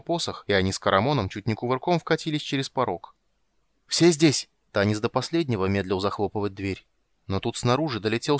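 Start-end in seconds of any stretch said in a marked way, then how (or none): 3.9-4.5 high, loud call to the group; urgent call. A narrator's reading. Escaping party checking everyone made it, urgent call. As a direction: shout it as they tumble through.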